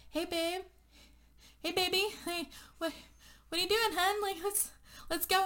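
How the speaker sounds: high, gentle imitated pleading; lightly voiced boyfriend coaxing his love. Shane coaxing Rachel out of the bathroom, high and gentle.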